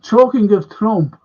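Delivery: ordinary talk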